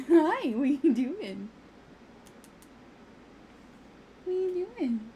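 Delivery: high, loud delighted coo; cooing pet voice. Real, not acted. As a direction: greet the cat in a squeaky sing-song. Cooing at the cat.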